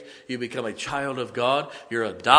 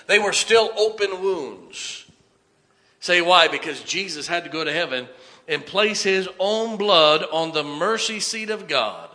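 loud, raised, driving sermon delivery; full-force preaching; 6 semitones higher, 7 dB louder than usual. Real, not acted. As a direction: proclaim it forcefully to the congregation.

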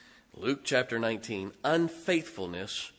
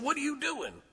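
put-on much higher, startled old man's question; imitating the old pastor. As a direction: mimic the pastor's alarm. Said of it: "He said".

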